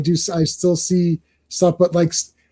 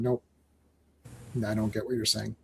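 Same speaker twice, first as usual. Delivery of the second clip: low, hushed mock bewilderment; retold baffled refusal; 7 semitones lower, 17 dB quieter than usual. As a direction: act out giving up, low and very soft. Retold baffled reply to others.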